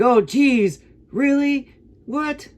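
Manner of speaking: very high, confused falsetto impression; nervous Butters. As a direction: mimic a nervous little character, squeaky and baffled. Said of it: "all confused, a little bit nervous".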